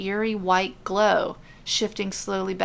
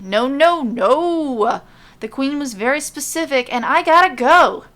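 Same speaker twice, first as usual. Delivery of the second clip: high and loud insistent no; adamant refusal; 5 semitones higher, 7 dB louder than usual. Acted. Messenger insisting to Mandy he must leave, loud. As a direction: refuse hard, won't be stopped. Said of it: "shakes his head adamantly".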